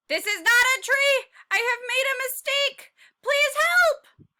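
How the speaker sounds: high, loud cry for help; panicked cry. Acted. Tiny calling for help, panicked and loud. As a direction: yell out in sudden panic.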